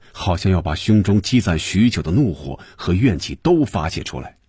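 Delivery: ordinary delivery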